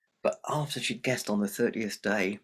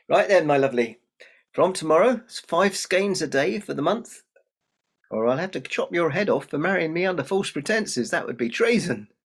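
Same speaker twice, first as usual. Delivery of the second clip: loud, higher-pitched royal decree; commanding king; 6 semitones higher, 7 dB louder than usual. Acted. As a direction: lay down the law as a hearty king. King lays down his mock threat.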